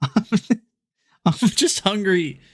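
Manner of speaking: high, slow laughing voice. Real, not acted. Joking to chat about being hungry, laughing. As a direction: squeak it out through laughter.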